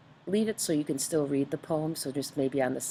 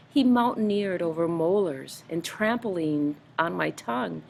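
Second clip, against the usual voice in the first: lively storybook reading with a bit more lift and energy; poem read aloud; 4 semitones higher, 3 dB louder than usual. Acted. Teacher reading a funny dentist poem to the class, lively storybook reading. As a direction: read it out like a fun poem for kids.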